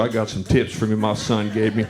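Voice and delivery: normal talking voice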